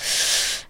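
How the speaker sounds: imitated long, soft sigh of disappointment; disappointed sigh. Mom walking up to the school, acted disappointed sigh. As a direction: let out a let-down breath, imitating a parent. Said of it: "she just looks so disappointed".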